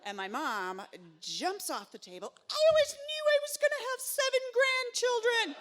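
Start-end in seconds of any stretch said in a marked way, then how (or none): 2.5-5.6 much higher and louder, laughing with glee; a grandmother squealing with delight; thrilled mom. Retold or imitated. Mom jumping up at the twin news, gleeful high squeal.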